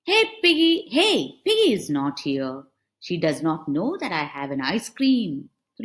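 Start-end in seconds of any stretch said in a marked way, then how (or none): 0.0-0.9 louder, higher call for a friend. Acted. Elephant calling for Piggy, loud and high. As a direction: call out across the field.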